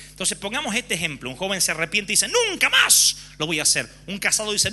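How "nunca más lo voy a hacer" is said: high, louder fervent promise; earnest vow. Retold or imitated. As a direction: swear it with passion. Young man's fervent vow. (promesa ferviente y fuerte)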